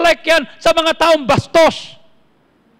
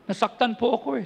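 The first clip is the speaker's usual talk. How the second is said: lower, much softer hurt plea; mock hurt plea; 6 semitones lower, 10 dB quieter than usual. Hurt member telling the pastor why he can't forgive, softly. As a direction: sound wounded and small.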